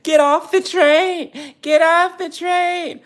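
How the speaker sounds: high, slow, breathy movie-line imitation; hammed-up impression of a film line; Dakota Johnson impression. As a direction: mimic the actress drawing out each word. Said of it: "And Dakota Johnson says".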